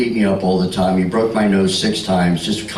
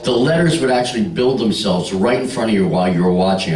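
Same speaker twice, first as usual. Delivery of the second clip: usual speaking voice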